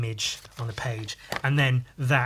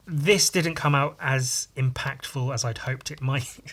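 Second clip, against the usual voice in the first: ordinary talk, nothing marked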